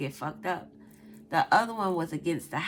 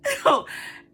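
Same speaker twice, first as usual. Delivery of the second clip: loud high cackle; high laugh; 5 dB louder than usual. Real, not acted. Telling viewers the spider story, loud high laughing.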